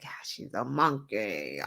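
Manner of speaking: low, soft mock quote.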